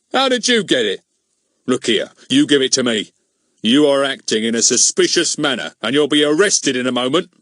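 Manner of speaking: high, loud official bluster; bully him with the law; blustering policeman. Acted. Goon's voice in the story.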